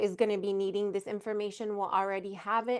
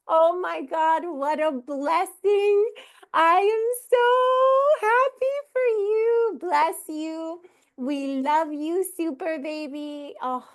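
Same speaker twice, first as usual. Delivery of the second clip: higher, slow and loud, gushing with joy; overjoyed congratulations; 8 semitones higher, 5 dB louder, slower than usual. Real, not acted. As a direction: pour out happiness for the new mom. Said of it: "I'm trying to contain myself".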